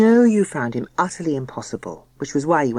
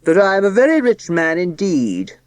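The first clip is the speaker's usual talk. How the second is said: solemn pompous voice; 4 semitones higher, 5 dB louder than usual. Acted. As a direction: announce it like a pompous patriarch. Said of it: "in a solemn, pompous voice".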